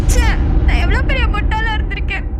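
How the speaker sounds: loud bitter outburst at her own foolishness; angry at herself. Acted. Kanmani berating herself alone, loud and bitter. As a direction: lash out at yourself, loud. Self-reproach after betrayal.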